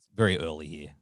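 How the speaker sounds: low, rough just-woke-up voice; morning voice. Real, not acted. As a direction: sound freshly out of bed. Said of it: "I've got my morning voice".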